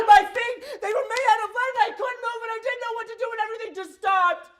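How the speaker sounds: high, loud and fast; panicked rush. Real, not acted. Father frozen in panic, racing words. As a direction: blurt it out in panic.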